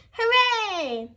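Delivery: high happy hooray; cheering. A narrator's reading. Children hearing it's snack time, excited. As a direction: cheer like a crowd of kids. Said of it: "cheered the children".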